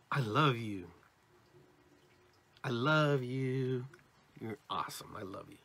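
heartfelt, lingering on love, softening at the end; smiling, with emotion. Shown as a demonstration. Speaker shows love with emotion, smiling warmth. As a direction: let a smile come through the words. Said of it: "there's a smile comes with that".

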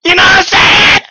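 high, loud shouted question; shouting. Real, not acted. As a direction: yell it at chat. Repeats it, shouting.

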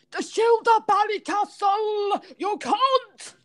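very high mock Irish accent; hammed-up Irish impression, squealed high; Jason. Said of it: "I'm doing an impression of you".

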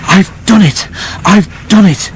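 high, jubilant bursts; puffed, proud triumph. A narrator's reading. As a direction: exult in short puffed bursts after a climb. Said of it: "he puffed proudly".

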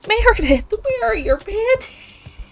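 very high, loud, drawn-out shriek; mock-scandalized, climbing to a squeak; hammed-up outrage. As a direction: squeal it slowly in scandalized disbelief. Mock outrage at character's missing clothes.

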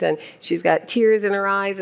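ordinary talk

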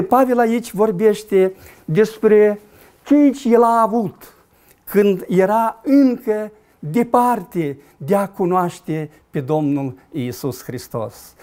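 normal talking voice

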